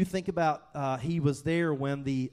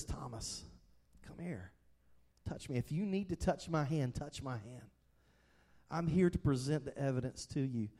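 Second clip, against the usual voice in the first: tender invitation; 3 semitones lower, 6 dB quieter than usual. Retold or imitated. Jesus invites Thomas tenderly.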